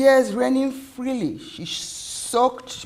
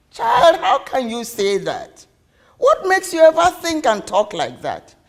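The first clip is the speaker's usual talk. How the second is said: loud, higher, taken-aback mother; shocked reproach; 4 semitones higher, 6 dB louder than usual. Acted. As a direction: protest in disbelief at the child. Mother shocked by child's question.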